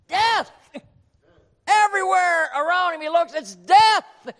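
high, loud cry of horror; preaching shout. Real, not acted. Preacher shouting to the congregation about death everywhere. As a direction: shout it like doom all around.

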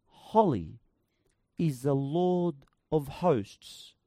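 slow, reverent reading of the verse; slow solemn recitation; solemn scripture reading. Acted. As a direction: read it like holy writ, drawn out.

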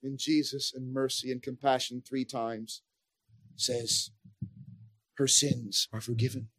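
almost breathe it, tender and hushed. Real, not acted. Tender hush after the shout.

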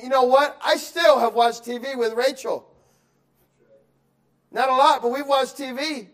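high, loud preaching confession; fervent preaching. Acted. Pastor admitting he still made time for TV, fervent preaching. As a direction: admit it boldly to the church.

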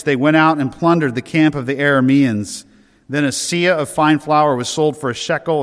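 ordinary talk, nothing marked

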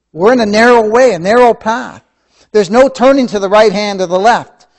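rising to a loud, high preaching climax; building preaching. Acted. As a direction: preach it harder and harder, up to a cry. Preaching builds to full force.

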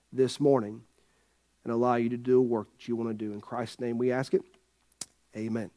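low, measured prayer voice; spoken prayer. Acted. Pastor leading the congregation in prayer, low and earnest. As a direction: pray softly and low, with pauses.

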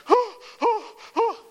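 very high, loud mock panic; shrieking hammed-up fright; mock terror. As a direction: beg not to be cursed, hamming it up. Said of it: "he'd be like".

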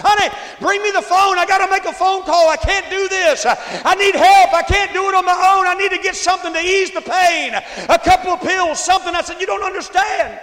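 loud, high pleading yell; desperate screaming. Retold or imitated. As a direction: scream for the phone in withdrawal agony. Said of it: "I remember screaming at my wife".

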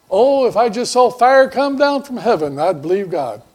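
loud, high mock boast of a doubter; hammed-up skeptic insisting he'd believe; doubter's boast. Preacher mocks the doubter who wants fire from heaven, loud and high.

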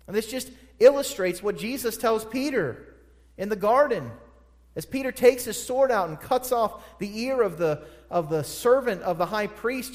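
high, loud, driving pulpit voice; full-throated sermon climax; preaching at full force. Acted. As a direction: preach it out, ringing and urgent. Preaching, building to Jesus quote.

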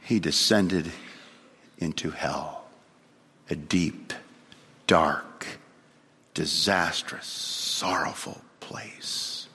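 low, slow, one heavy word at a time; dark, measured pulpit gravity; grave, solemn descent. Real, not acted. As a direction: sink down with long pauses, fading at the end. Preacher depicts descent into hell.

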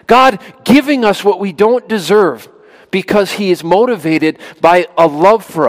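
high, loud preaching voice. Acted. Preacher urging the congregation to trust God, full force. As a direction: preach it with fire.

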